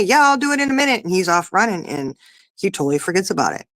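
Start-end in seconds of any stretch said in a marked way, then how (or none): 0.0-1.0 higher, fast, breezy brush-off; imitating son. Mom imitates her son brushing off chores, higher and fast. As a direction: toss it off like a kid already running. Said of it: "And he'll say".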